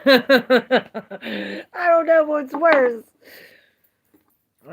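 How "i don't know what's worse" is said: slow, loud, high, laughing through "I don't know what's worse". Real, not acted. Repeating her joke to chat, slow and laughing.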